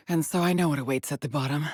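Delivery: normal talking voice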